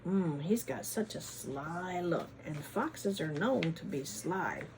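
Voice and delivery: ordinary delivery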